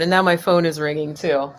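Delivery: ordinary talk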